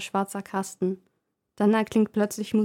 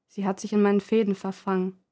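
ordinary delivery

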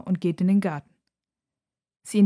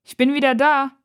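high, raised mother's call; loud, higher-pitched homecoming call; 7 semitones higher, 5 dB louder than usual. A narrator's reading. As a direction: announce yourself from a distance. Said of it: "hört Nora ihre Mutter rufen".

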